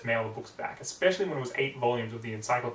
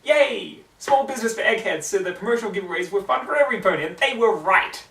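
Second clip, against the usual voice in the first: higher, louder cheering; excited librarian celebrating; happy cheer; 7 semitones higher, 7 dB louder than usual. Acted. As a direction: clap and cheer it out. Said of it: "clapped happily".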